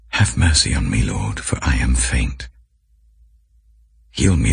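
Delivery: usual speaking voice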